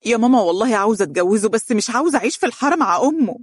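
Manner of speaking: high, fast tearful pleading; broken, tearful voice. A narrator's reading. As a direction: whine it out as if crying. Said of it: "متقطع".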